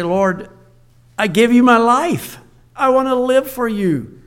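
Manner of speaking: fervent prayer. Acted. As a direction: pour the heart out to God. Voiced prayer of surrender.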